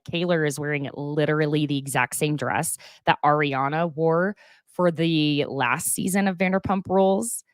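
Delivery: ordinary talk, nothing marked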